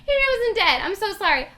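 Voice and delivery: high, loud real embarrassment; flustered apology. Real, not acted. Storyteller taking back a mistaken death, loud and flustered. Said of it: "very excited".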